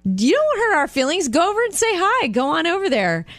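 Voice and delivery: high, urging push to go say hi; lightly voiced friends egging her on; mock encouraging friends. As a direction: nudge her to go over. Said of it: "we go".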